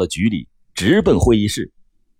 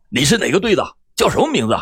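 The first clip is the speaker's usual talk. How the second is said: raised voice; 5 semitones higher, faster than usual. A narrator's reading. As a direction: question sharply in mock-raised voice. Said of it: "司机大声地说".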